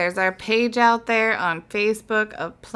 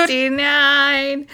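suggestive; 5 semitones higher, much slower than usual. Real, not acted. Joking on episode number.

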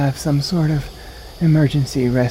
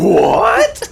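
shocked; 5 dB louder than usual. Real, not acted. Shocked by the reveal.

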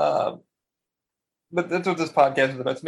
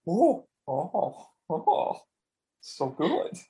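blissed-out; 3 semitones higher, much slower than usual. Retold or imitated. Listener reliving the audiobook on the bus, blissed-out. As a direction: savor it, acted-out bliss.

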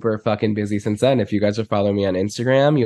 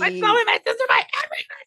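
high gushing squeal; much faster than usual. Acted. Gushing reunion with friend.

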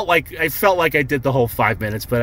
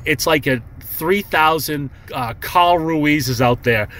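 ordinary talk, nothing marked

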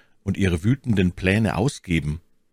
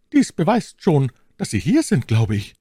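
high, coy lady's voice; feigned embarrassment; 9 semitones higher, 4 dB louder than usual. A narrator's reading. My Lady answers his plea for love, feigning embarrassment. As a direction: mildly play embarrassed, pitched high. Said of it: "Verlegenheit heuchelnd".

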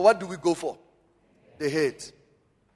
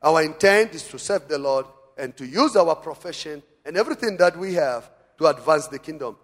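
ordinary delivery

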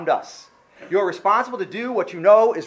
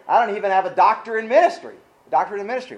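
usual speaking voice

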